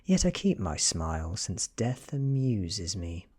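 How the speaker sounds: ordinary delivery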